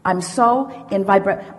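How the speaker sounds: ordinary delivery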